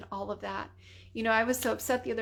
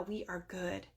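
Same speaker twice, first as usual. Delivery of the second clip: ordinary talk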